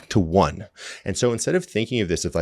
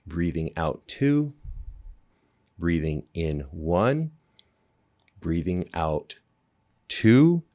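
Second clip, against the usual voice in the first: slow breath counting; slower than usual. Shown as a demonstration. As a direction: count each breath slowly and calmly. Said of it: "let's try to breathe together".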